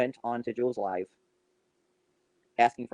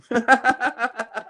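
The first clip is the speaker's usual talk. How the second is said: scornful laugh; 10 semitones higher, 5 dB louder than usual. Real, not acted. Scoffing at caring about Jules.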